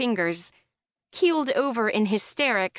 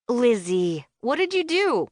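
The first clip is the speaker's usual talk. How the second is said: disappointed; 4 semitones higher than usual. Acted. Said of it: "She's disappointed. A twinge angry".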